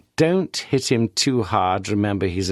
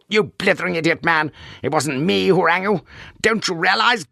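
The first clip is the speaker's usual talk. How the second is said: angry rebuke; 9 semitones higher than usual. Acted. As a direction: tear into him. Governor berates the officer.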